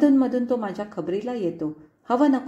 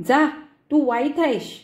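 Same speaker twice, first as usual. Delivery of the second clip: put-on sulky toddler; 5 semitones higher, 5 dB louder than usual. Angry toddler pushes mother away.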